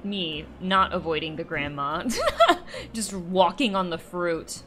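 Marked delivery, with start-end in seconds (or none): 2.1-2.9 short high burst of laughter; amused laugh. Real, not acted. Player caught walking on the fruit, amused laugh. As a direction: break into a quick giggle.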